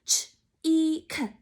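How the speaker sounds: "Ch, E, K" as separate sounds, ch, ee, k; sounded out. Shown as a demonstration. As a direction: say each sound of cheek alone with pauses. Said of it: "How many sounds were in the word cheek?".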